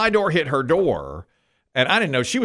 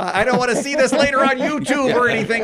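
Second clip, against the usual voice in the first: nasal honky voice; 10 semitones higher than usual. Retold or imitated. Mocking himself to the onlookers, hammed-up and nasal. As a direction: honk it out like a goose. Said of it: "a guy who talks like this".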